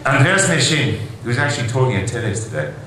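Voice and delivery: usual speaking voice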